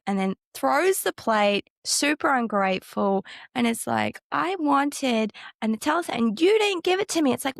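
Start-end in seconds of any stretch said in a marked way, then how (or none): 4.3-7.3 put-on whiny child. Ungrateful daughter's tantrum.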